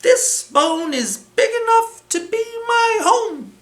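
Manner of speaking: high, small mouse-like voice; high squeaky little voice; tiny character voice. Acted. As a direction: speak as a tiny creature pleased with his find. Itty Bitty character voice.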